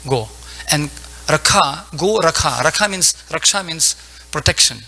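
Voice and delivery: ordinary delivery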